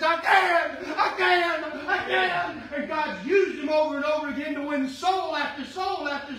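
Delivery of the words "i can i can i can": louder and higher: I can, rising in confidence; louder triumphant. Retold or imitated. Son's new confidence, told by his father, louder. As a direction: ring it out with conviction. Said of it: "all I hear from him is".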